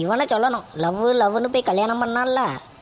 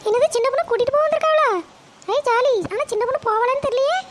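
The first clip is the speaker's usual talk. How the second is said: put-on much higher young voice, gleeful; daughter-in-law, delighted; 12 semitones higher than usual. Daughter-in-law gloating that her sister-in-law may leave, high and gleeful. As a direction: cheer like a young woman hearing good news. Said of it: "மருமகளே, போய் coffee கொண்டு வாமா".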